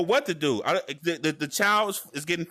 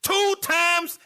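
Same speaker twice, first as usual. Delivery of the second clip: high, loud mock frustrated mother; much higher impatient mom voice; 12 semitones higher, 4 dB louder, slower than usual. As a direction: play the exasperated mom helping with homework. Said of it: "mothers get kinda like".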